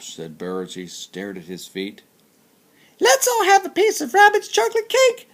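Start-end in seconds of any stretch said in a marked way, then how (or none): 3.0-5.3 very high, squeaky little chipmunk voice; tiny chipmunk voice. Acted. Chipmunk greeting Bear at the party, very high squeaky voice. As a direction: pitch way up like a small sweet chipmunk. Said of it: "said Chipmunk quickly".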